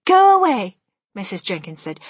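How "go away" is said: high, loud wifely order; angry dismissal. A narrator's reading. Missus Jenkins dismisses grandmother, high and cross. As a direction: send her off in a high, loud voice.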